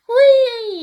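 high, very loud joyful whoop; little angel whooping with delight mid-air. A narrator's reading. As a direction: shout it with glee while flying. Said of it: "jauchzt Bruno".

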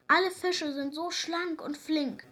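mildly hammed-up whining; wailing. A narrator's reading. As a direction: moan like a sorry storybook whale. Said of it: "jammerte Wal".

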